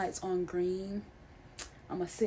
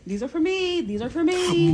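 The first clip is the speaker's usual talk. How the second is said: excited claim; 9 semitones higher, 8 dB louder than usual. Real, not acted. Grabbing the cookies gift.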